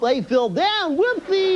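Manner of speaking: high, sing-song "whoopsie"; mock sheepish airline staff. Comedian imagining the goofy plane pulled from a swamp, sing-song. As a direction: shrug off the crash childishly.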